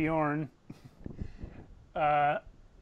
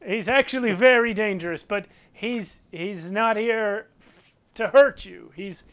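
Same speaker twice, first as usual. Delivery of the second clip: raised voice, halting correction; mildly urgent appeal; 5 semitones higher, 8 dB louder than usual. Acted. Bjorn admitting Throck is dangerous but vouching, halting urgent appeal. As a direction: insist to the crowd, hesitating partway.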